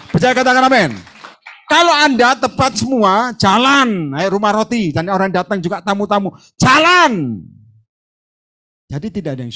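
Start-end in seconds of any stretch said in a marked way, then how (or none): 0.1-7.5 loud, high preaching climax; full-force preaching. Real, not acted. Pastor rousing the congregation, loud full-force preaching. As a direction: drive the church to its feet.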